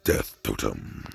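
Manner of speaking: very low, slow, ominous utterance; Revenant's death totem call. Acted. Revenant activates his smoke power, very deep slow voice. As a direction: intone it deep and slow, softly. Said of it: "As then he says".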